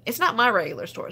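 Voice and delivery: ordinary delivery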